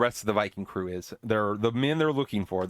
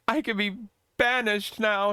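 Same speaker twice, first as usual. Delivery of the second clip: much higher, petulant mocking prince voice; whiny prince; 9 semitones higher, slower than usual. Prince mockingly scolds the woman who saved him, whiny. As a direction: sneer at her like a spoiled brat.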